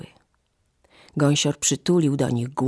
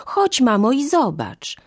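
put-on high, louder excited child; eager urging; 8 semitones higher, 5 dB louder than usual. A narrator's reading. Children pull mother to the yard, eagerly urging. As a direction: urge her along, voice pitched up.

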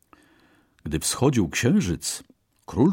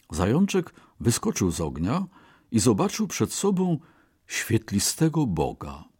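ordinary delivery

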